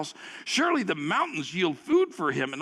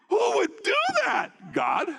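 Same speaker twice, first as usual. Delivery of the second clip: amazed shout; 13 semitones higher than usual. Real, not acted. Preacher marvels at the scene.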